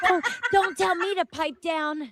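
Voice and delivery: high-pitched, laughing imitation of a ranter; mock indignant mimicry. Host mimics the woman in the clip, mock indignant. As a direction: mimic her outrage in a high voice.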